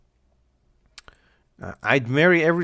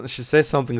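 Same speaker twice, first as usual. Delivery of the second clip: usual speaking voice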